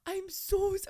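a hint of a high timid girl; nervous fan. The fan girl apologizing, lightly voiced nervous. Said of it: "so nervous".

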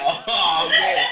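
high, giggling mimic of a friend; laughing imitation. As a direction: mimic him while laughing. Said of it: "he said".

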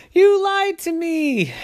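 put-on high, slow accusing child; a high little boy accusing his sister; betrayed kid. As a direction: whine the betrayal. Ryder feels tricked.

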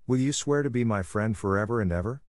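ordinary delivery, nothing marked

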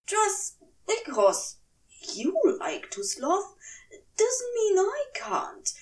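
tipsy, wounded dignity, trailing to a whisper; grave dignity. Acted. Aziraphale defending himself to Crowley, with tipsy dignity. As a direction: draw yourself up, ending in a hushed whisper. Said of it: "said with grave dignity".

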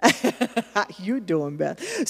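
slow, drawn-out flirty greeting with a laugh; flirty wink. Shown as a demonstration. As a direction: wink at her as you say it. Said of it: "Give 'em a little wink".

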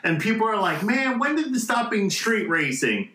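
ordinary talk, nothing marked

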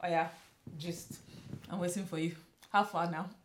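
normal talking voice

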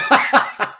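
high, loud, breathless burst of laughter; airy laugh. Real, not acted. After reading Héctor's comment, real breathless laugh. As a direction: let a big laugh escape.

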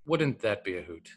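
a hint of Alan Watts, unhurried and amused; Alan Watts imitation. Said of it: "I won't try to do his voice".

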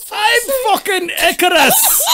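high, slow, laughing Scottish boast; Billy Connolly-style brag through giggles; mock Scottish god proclaiming himself; Scottish Icarus. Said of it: "doing a Billy Connolly".

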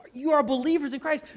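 high, loud, exasperated outburst; frustrated shout. Retold or imitated. James shouting at quarrelling believers, frustrated and loud. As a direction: shout it like a preacher who can't believe his flock. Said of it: "I think he's shouting".

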